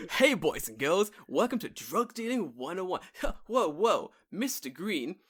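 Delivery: hammed-up camp meeting host; camp counselor. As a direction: greet the kids like an over-eager camp leader. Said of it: "you're like a fucking camp meeting".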